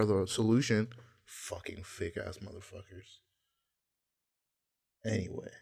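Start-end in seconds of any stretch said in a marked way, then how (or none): none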